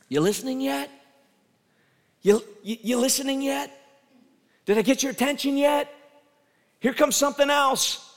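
high, loud call to get attention. Pretend. God calling down to Jonah, a high loud call. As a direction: shout up to Jonah to wake him.

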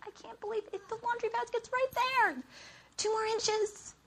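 high, strained squeal of frustration; mad disbelief. Retold or imitated. Wife mad that laundry basket's right there, squealing disbelief. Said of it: "I am so mad at him".

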